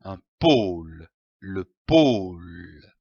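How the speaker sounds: pôle with a long, low O each time; drawn-out O. Shown as a demonstration. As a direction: drag the O in pôle, letting it fall. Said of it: "On traîne le O".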